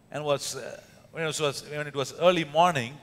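normal talking voice

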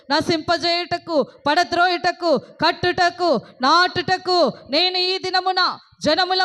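high, loud, driving sermon voice; full-force preaching. Acted. Preacher rousing the congregation against fear, high and loud. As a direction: thunder God's promise over the crowd.

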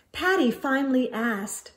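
usual speaking voice

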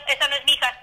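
ordinary delivery

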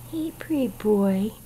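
whispered coo to the pet; soft breathy whisper to a kitten; hushed whisper. Real, not acted.

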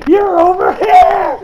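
high, loud villain cry; mock triumphant shout. Acts out villain locating opponent.

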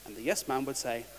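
ordinary talk, nothing marked

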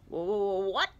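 loud, stuttering hoot of confusion; hooting, baffled character voice; Owl. A narrator's reading. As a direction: stammer it like a startled bird. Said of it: "Owl replied from above".

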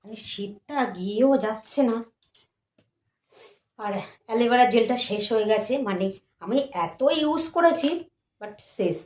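hushed voice. Real, not acted. Baby asleep, talking very softly.

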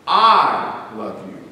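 I said low, slow and weighty; stress on I. Shown as a demonstration. Preacher showing the congregation how stressing "I" changes the phrase. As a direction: lean hard on the word "I". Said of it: "if you stress the "I".

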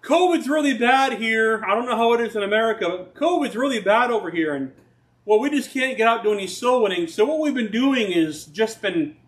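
lightly voiced missionary with excuses. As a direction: act the missionary making excuses. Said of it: "And it's like, Oh".

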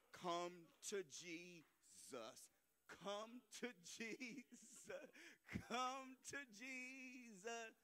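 slow, drawn-out altar call plea; slow pleading call to come to Jesus. Acted. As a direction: stretch each word, calling them forward.

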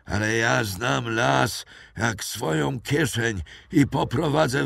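put-on frightened old poacher naming himself to captors; old poacher Mathieu. A narrator's reading. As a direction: play a wary old woodsman.

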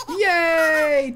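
high, loud delighted cheer. Real, not acted. Streamer cheers for the baby, high and loud. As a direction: cheer like a proud parent.

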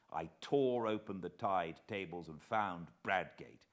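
ordinary delivery

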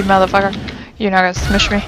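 normal talking voice

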